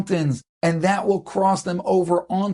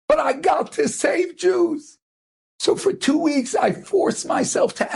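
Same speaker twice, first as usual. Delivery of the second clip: tearful confession; 8 semitones higher than usual. Retold or imitated. Smuggler revealing himself to the family he saved, high tearful pleading. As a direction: pour out the apology, voice lifted and breaking with emotion. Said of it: "he's crying with them. And he says".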